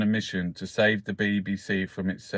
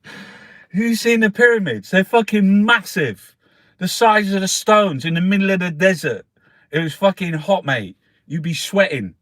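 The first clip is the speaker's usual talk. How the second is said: loud, higher, hammed-up bloke ranting about the pyramids; mock loud rant; 9 semitones higher, 8 dB louder than usual. Mocking pyramid fans to his mate, loud hammed-up rant. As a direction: bellow it like a sweaty tourist showing off.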